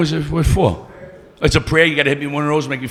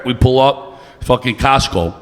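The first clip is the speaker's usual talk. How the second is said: ordinary delivery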